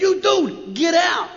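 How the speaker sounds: half-playful shout throwing someone out; mock shout. Club members throwing the speaker out, mock yelling. Retold being kicked out of club.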